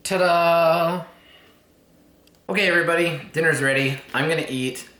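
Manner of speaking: loud, high announcing call; yelling across the room. Real, not acted. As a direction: call everyone to dinner from afar. Announcing dinner to everybody.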